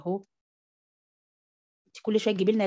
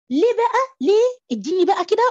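exaggerated question; 10 semitones higher, 7 dB louder than usual. Teacher mock-asks students.

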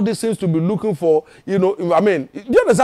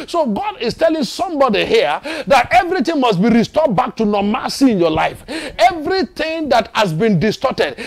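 raised, higher-pitched preacher's delivery; impassioned preaching; 4 semitones higher than usual. Real, not acted. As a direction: preach it with mounting force. Preaching at rising full force.

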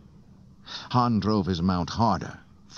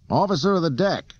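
high, raised calling voice; yelling; 11 semitones higher, 4 dB louder than usual. A narrator's reading. Han summoning the deck officer, yelling. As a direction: yell out for attention, pitched well up. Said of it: "Han yelled".

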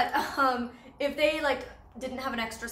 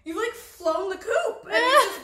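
loud, slow, laughing gush; gushing proud; 6 semitones higher, 6 dB louder than usual. Retold or imitated. Vlogger gushing over friend's new outfit, laughing. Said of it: "I'm like".

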